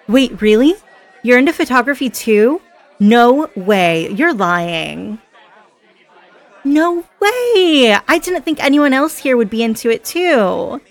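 loud, high delighted surprise; can't believe your luck, voice jumping up; thrilled and incredulous; excited disbelief. Acted. Surprised to share a hobby.

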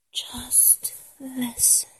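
very soft, slow whisper; intimate whisper. Acted. Fan telling idol to listen, intimate whisper. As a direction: breathe it out slowly, barely voiced.

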